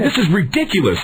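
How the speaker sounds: high exasperated outburst; mock yell of an outraged customer; acted indignant protest; re-enacted screaming. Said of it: "I'm screaming".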